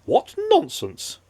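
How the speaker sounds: slow, high scoffing snort; Mister Happy snorts in disbelief, slow and high; snorting scorn. Acted. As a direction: scoff at the silliness, drawn out. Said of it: "snorted Mister Happy".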